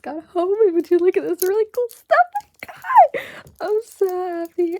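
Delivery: high and loud, bursting with excitement; over-the-top thrilled shopper voice; giddy excitement. Acted. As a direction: squeal it like you just got home with treasure. Excited unboxing just got home.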